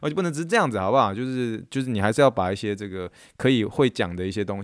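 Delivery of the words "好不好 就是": usual speaking voice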